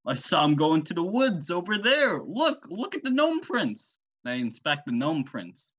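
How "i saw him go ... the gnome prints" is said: high character voice climbing higher; excitable high-pitched witness; Fisherman Joe. Acted. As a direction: point it out, rising into a high cartoon pitch. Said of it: "he was like".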